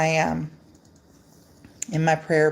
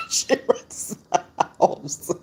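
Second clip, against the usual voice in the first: slow, laughing through the words; real laughter over the line; 9 semitones higher than usual. Real, not acted. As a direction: giggle it out slowly. Laughing about her cat.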